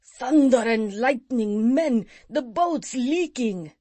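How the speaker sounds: pirate captain bellowing that the boat leaks; bellowed. A narrator's reading. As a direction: roar the alarm to the crew. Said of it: "suddenly bellowed out".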